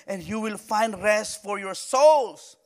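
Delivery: stretched out, each word weighed; slow emphatic scripture. Real, not acted. Preacher reading Jesus' promise to the weary, slow and weighty. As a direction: let the words sink in slowly.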